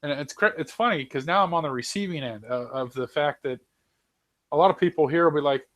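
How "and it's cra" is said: normal talking voice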